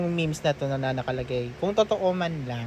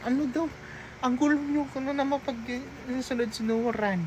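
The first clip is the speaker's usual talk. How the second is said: high mock voice; 9 semitones higher, 4 dB quieter than usual. Mocking the couple's bickering.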